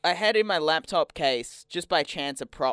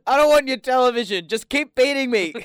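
loud and higher, hammed-up begging; mock pleading shout; 10 semitones higher, 11 dB louder than usual. Burglar begging the homeowner to keep beating him, hammed-up loud plea. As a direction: beg the homeowner for a beating, at full volume.

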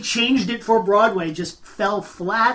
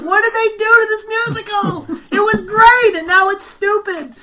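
put-on much higher, indignant teen whine; high-pitched outrage; 12 semitones higher than usual. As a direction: squeal it like a horrified fan. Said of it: "I'm like".

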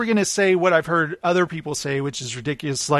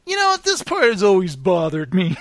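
mock nitpicker. Imitating a fan who has seen it too often, mock griping. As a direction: whine it like a fussy fan. Mimics nitpicking repeat viewer.

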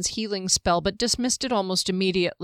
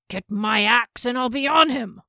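higher, louder gruff threat; growling dwarf; 4 semitones higher, 4 dB louder than usual. Acted. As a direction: growl it out, itching to fight. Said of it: "Janek let out a growl".

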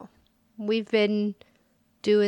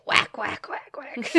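whispered whacks; 4 dB quieter than usual. Pretend. Lizzie Borden punchline.

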